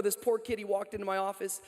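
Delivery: ordinary talk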